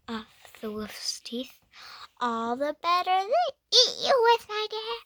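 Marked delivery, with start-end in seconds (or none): 2.2-5.0 high, loud wolf roar; the wolf pounces on the girl with a loud high shout. Acted. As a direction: shout it as he leaps from the bed. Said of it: "shouted the wolf".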